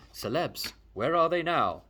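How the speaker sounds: ordinary delivery, nothing marked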